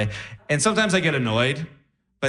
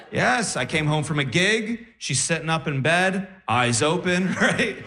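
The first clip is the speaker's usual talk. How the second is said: ordinary delivery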